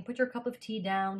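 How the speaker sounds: normal talking voice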